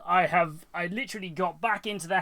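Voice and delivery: breathless after rushing home; panting between phrases; out of breath. Real, not acted. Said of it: "I am out of breath".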